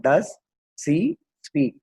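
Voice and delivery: "does... she... speak", a pause after each word. Shown as a demonstration. Teacher building the question for students, word by word. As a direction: break the question into three separate words. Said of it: "அப்ப எப்படி வரும்?".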